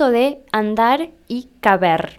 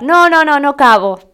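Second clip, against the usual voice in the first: raised, louder string of protests; acted insistent refusal; 4 semitones higher, 5 dB louder than usual. Friend declining to squeeze in, insistent refusal. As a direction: wave off the offer emphatically, starting high.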